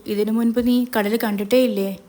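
plain reading voice

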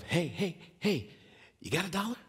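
high, jittery plea at normal volume; nervous approach. Retold or imitated. Eddie asking the pastor for a dollar, nervous and high-pitched. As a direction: imitate a nervous man edging up to ask. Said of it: "kinda nervously shaking".

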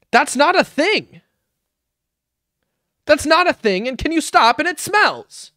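high-pitched exasperated mom impression; imitated scolding mom. Streamer quoting mom about the bottles, high scolding imitation. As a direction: imitate a mom telling off her kid. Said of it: "she was like".

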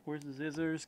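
normal talking voice

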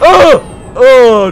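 loud, high yelp, then groan; real startled cry; scared scream. Real, not acted. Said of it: "Why did that scare me so much?".

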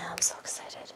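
whispered thrill; giddy hushed whisper; excited whisper. Real, not acted. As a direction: whisper it like you can barely contain it. Unboxing a long-awaited package.

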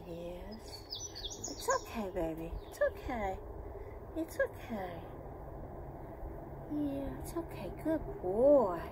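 soft, hushed reassurance; gently calm a nervous pet; soothing a scared dog. Real, not acted.

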